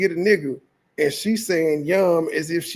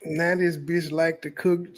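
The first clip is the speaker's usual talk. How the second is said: ordinary delivery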